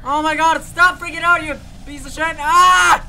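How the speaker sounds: loud scared swearing; panicked yelling. Real, not acted. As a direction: yell at the thing. Yelling at the monster.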